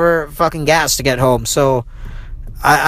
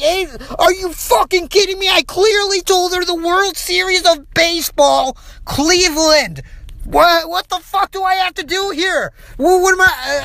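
furious; 11 semitones higher than usual. Retold or imitated. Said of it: "I'm fucking furious at this point".

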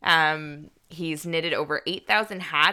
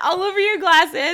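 laughing; 12 semitones higher, 7 dB louder than usual. Real, not acted. Teasing while both laugh.

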